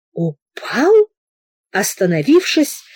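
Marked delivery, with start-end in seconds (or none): 0.1-0.9 hoarse questioning. A narrator's reading. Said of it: "прохрипел генерал".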